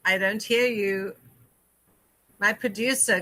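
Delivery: usual speaking voice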